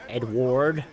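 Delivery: questioning put-on American "Edward"; American accent. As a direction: try out an American "Edward", sounding the R. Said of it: "how do Americans say it?".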